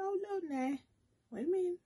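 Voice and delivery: high, startled cry of alarm. Retold or imitated. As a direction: squeal it in sudden panic. Retelling her panic at coughing.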